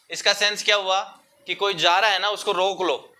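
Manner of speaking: normal talking voice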